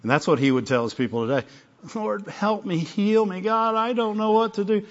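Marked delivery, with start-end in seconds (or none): 2.0-4.8 soft, high, whiny prayer; whining plea. Acted. A believer begging God for help, whining. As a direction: whine it pleadingly in a raised, quiet voice. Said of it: "Quit whining so I can help you".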